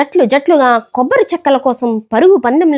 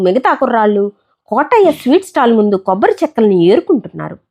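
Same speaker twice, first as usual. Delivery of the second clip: ordinary delivery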